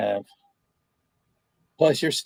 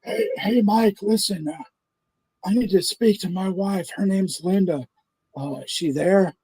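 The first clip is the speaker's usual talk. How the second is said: higher, pleading husband voice; prank caller; 8 semitones higher than usual. Retold or imitated. Prank caller asking the pizza clerk for his wife, pitched-up worried husband. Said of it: "And he's like".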